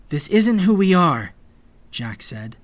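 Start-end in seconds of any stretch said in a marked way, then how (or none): 0.1-1.3 loud, raised conviction; forceful objection; firm protest. A narrator's reading. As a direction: take a stand loudly. Refusing to flee, protesting.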